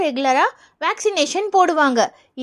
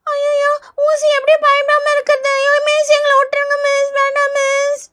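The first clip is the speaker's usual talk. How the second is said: put-on high whiny child begging off; scared pleading; 6 semitones higher than usual. Pupil begs teacher to be let off the injection, scared pleading. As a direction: plead like a frightened little pupil.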